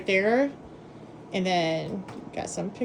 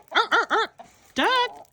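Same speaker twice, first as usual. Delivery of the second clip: duck voice; 12 semitones higher than usual. Pretend. Talking to a duck.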